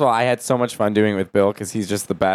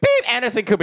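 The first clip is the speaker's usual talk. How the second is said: high-pitched buzzer-happy contestant; imitated eager contestant; 10 semitones higher than usual. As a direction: mimic a contestant blurting after a high beep. Contestant buzzes in again over host.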